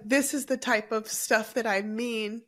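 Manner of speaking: ordinary talk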